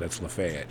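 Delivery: LAFAYETTE said "luh-FAY-et", low and soft; Nashville pronunciation. Shown as a demonstration. Resident cites the town's lie, Lafayette as "luh-FAY-et". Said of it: "Why do we perpetuate this lie".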